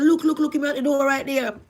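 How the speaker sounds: high, loud frightened call; screamed in fright. Retold or imitated. Pointing out the ghost to her sister, frightened cry. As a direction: shout to the sister in terror. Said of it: "I grabbed my sister and screamed".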